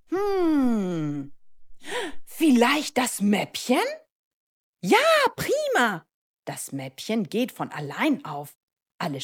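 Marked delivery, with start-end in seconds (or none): 0.1-3.8 high, drawn-out hum, then tentative question; pondering. Acted. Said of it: "Leo denkt nach und fragt". 4.8-6.1 very high, loud cheer; jubilant praise. Acted. Schoolbag cheers correct guess.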